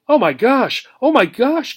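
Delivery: high, loud, rising panic cries; a hamster freaking out, voice climbing. Acted. Hamster finds her water nearly gone, cries out in panic. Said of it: "cried Obie, freaking out".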